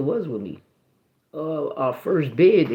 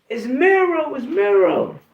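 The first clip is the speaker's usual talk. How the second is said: high, loud holler; raised, excited retold shout; imitated calling out; 11 semitones higher, 6 dB louder, slower than usual. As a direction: call out who you are to a friend far off. Homie calling out his name, retold.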